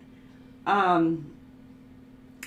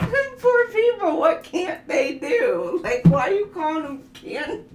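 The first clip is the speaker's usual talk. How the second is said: laughing mock pity; 9 semitones higher, 7 dB louder than usual. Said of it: "And I'd be like".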